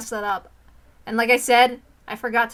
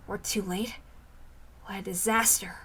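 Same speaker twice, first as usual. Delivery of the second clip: breathy whisper of dismay; 3 semitones lower, 8 dB quieter than usual. Acted. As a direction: breathe it out in despair. Grim realization, whispered.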